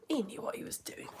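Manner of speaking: under the breath; hushed aside. Real, not acted. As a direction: drop to a whisper, as if confiding. Hushed aside after praising the line.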